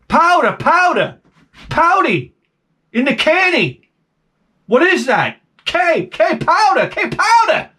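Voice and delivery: slow, high, over-clear words for a foreigner; talking slow and high to a barber who doesn't get it; miming powder. Retold or imitated. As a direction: spell it out slowly, high and exaggerated.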